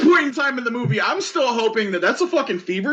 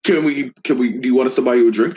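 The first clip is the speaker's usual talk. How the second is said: much lower, fast, rushed; nervous mock offer; 12 semitones lower, faster than usual. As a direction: hurry the offer like scared men. Nervous thugs offering a drink.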